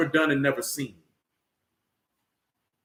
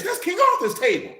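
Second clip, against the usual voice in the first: higher, shouted punchline; 11 semitones higher than usual. Real, not acted. Shouting at the audience that chivalry is British. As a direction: call it out like a punchline.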